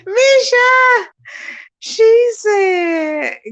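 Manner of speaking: high, loud, drawn-out wail of amusement; hysterical amusement. Real, not acted. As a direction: cry it out, helpless with mirth. Overcome with laughter at comment.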